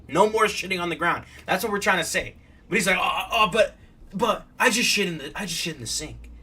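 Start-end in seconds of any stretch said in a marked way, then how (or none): none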